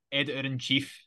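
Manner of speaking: usual speaking voice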